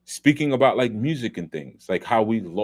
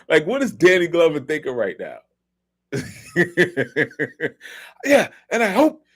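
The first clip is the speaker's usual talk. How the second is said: laughing; 9 semitones higher, 5 dB louder than usual. Real, not acted. Cracking up at Gibson recording.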